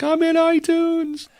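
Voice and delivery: high, loud, squealing disbelief; ecstatic imitated squeal. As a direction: squeal it like you can't believe you did it. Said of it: "she called me the next day crying".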